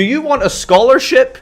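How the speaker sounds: high, loud sarcastic question; hammed-up sarcastic wonder; mock astonished praise. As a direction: offer it in mock awe.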